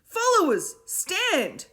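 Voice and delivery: high, loud order to stand; commanding shout. A narrator's reading. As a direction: command the crowd to rise. Will repeating the order.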